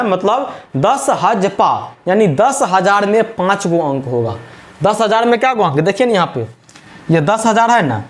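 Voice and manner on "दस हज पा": "दस हज पा" stretched, piece by piece; slow demonstration. Shown as a demonstration. Teacher showing the memory word, "दस हज पा" slow and separated. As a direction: say das, haj, paa slowly for "दस हज पा". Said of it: "जहाँ पे हम बोल रहे हैं".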